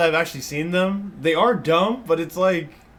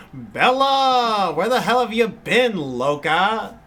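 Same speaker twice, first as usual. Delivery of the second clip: high, loud dramatic line reading; movie line impression; 7 semitones higher, 5 dB louder, slower than usual. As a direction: belt out the famous movie line. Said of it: "You wanna say the, the line?".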